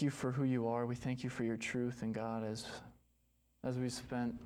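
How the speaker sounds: earnest, unhurried prayer voice; closing prayer. Acted. Pastor leading the church in a closing prayer, earnest. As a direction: pray over the congregation.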